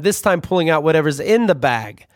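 ordinary talk, nothing marked

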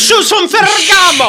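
Andrew Dice Clay. Retold or imitated. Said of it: "Bye, Dice".

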